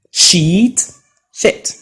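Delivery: "Sheet" long rising ee, "shit" short clipped i; sheet versus shit. Shown as a demonstration. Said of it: "pronounced in a different way".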